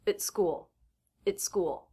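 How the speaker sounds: "It's school" with a stopped T; "It's" quick and light, "school" stressed; stop T before school. Shown as a demonstration. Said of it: "so the T will be a stop T".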